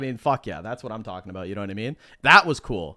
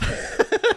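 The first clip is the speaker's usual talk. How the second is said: breathy laugh. Real, not acted. Laughing at Hitman's remark.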